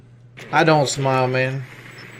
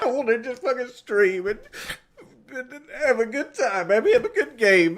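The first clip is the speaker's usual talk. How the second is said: high voice between laughing and crying; tearful breakdown; 6 semitones higher than usual. Real, not acted. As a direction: break down, half laughing, half sobbing. Streamer breaking down over game.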